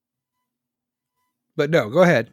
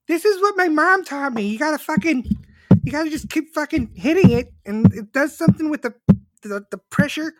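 very high, loud mock-female voice; hammed-up high-pitched protest; woman in the kitchen; 13 semitones higher, 6 dB louder than usual. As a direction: squeal it out defensively.

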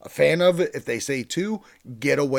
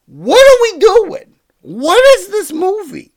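much higher, much louder disbelieving yell; baffled outcry; 13 dB louder than usual. Real, not acted. Reviewer yelling at the absurd Gremlins sequel in disbelief. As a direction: shout it out in total disbelief.

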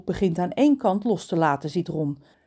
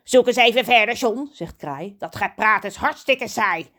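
louder, higher whiny crow; bored crow; 3 semitones higher, 6 dB louder than usual. Acted. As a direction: complain about dull talk. Kraai verveeld. (verveelde kraaienstem)

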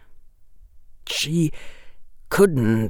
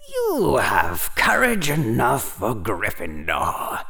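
slow, drawn-out calm hat voice; unhurried, soothing voice of an old magic hat; calm Sorting Hat; 3 dB quieter than usual. A narrator's reading. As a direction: speak slowly and calmly, stretching the words. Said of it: "said the calm voice of the Sorting Hat".

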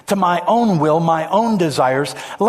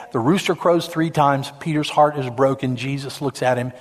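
ordinary talk, nothing marked

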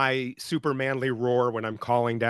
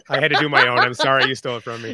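normal talking voice

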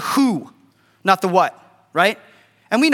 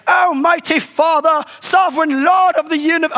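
mock King James prayer; 7 semitones higher than usual. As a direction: ham up an overly formal prayer. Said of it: "You guys don't talk in King James".

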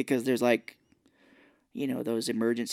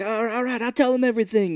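panicked giving in; 9 semitones higher, 4 dB louder, faster than usual. Retold or imitated. Scared passenger giving in.

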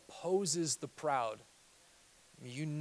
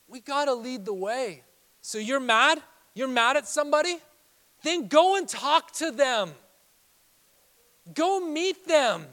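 high, loud, emphatic exhortation; forceful preaching; 10 semitones higher, 11 dB louder than usual. Real, not acted. Pastor telling the offended to go talk, preaching forcefully. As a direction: press the congregation, stretching the final commands.

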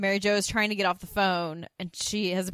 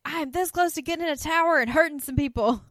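higher and louder, a hammed-up threat; mock sass; 9 semitones higher, 6 dB louder than usual. Suzanne threatening the others, in a hammed-up higher voice. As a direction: play the threat over the top. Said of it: "she's like".

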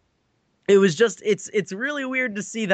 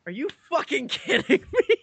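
laughing disbelief; 8 semitones higher than usual. Retold or imitated. Retold own disbelief, laughing.

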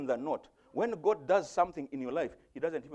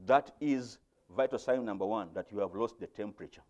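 ordinary talk, nothing marked

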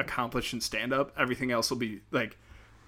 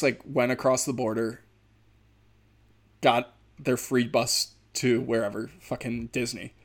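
usual speaking voice

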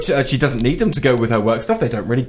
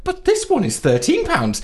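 high, gotcha mock emphasis; smug; 14 semitones higher than usual. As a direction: land the point smugly. Playing the trump card.

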